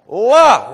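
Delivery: loud, high, strained "Love" cut short; imitating a brain-injured woman's loud effortful "Love"; imitated vocal sound. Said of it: "Terry Schiavo would get the L's".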